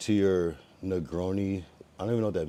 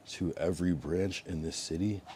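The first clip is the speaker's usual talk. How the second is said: normal talking voice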